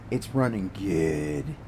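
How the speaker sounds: it's running good dragged out low and slow; lazy satisfied drawl; slow drawn-out repeat. Shown as a demonstration. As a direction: savor the repeat, stretching every word.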